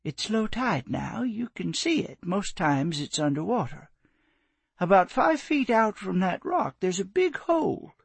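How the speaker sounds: remember the redfish fondly; old woman reminiscing. Acted. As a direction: widow telling a stranger about her boys' fishing hole. Narrator voices Willow.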